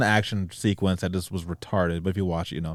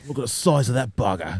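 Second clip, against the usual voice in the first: put-on broad Aussie accent; joining in with a hammed-up Australian accent; mock Australian; 3 semitones higher than usual. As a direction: marvel at the beast like an Australian. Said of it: "a good Aussie accent".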